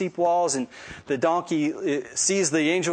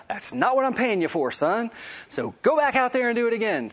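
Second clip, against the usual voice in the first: higher-pitched mock scolding; exasperated king; 7 semitones higher than usual. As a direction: order him back, acting fed up. Balak scolds Balaam in retold story.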